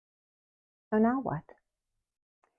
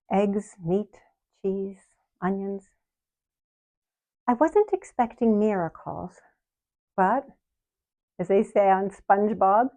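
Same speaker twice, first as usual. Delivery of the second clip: ordinary talk, nothing marked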